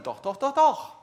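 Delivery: last "doch." shouted high and loud after three quick "doch"; emphatic insistence. Real, not acted. Preacher insists to the congregation, final "doch." punched high and loud. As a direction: hammer home the final "doch.". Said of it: "insisting to the congregation".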